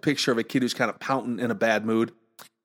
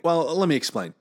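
normal talking voice

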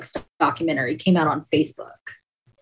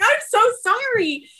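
emotional apology. Retold or imitated. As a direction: say sorry with a lump in the throat. Apologizing to her sister.

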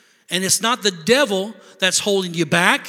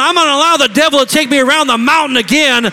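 preaching shout; 8 semitones higher, 12 dB louder than usual. Acted. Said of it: "start going".

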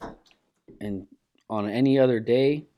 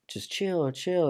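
higher-pitched imitation of a worried wife; lightly voiced wife; 8 semitones higher, much slower than usual. Wife telling angry husband to back down, lightly imitated. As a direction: lightly mimic a wife calming her husband. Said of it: "you can hear his wife. She's like".

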